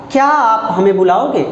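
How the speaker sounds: क्या hit hard, then falling; stress on क्या. Shown as a demonstration. Teacher showing the class, stress on क्या. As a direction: lean on the word क्या. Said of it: "क्या शब्द पे जोर दिया जा रहा है".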